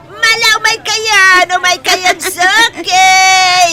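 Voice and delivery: slow, high, stretched-out call; calling customers. Acted. Nana Usang beckoning her regular buyers, stretched call. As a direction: sing it out to the regulars.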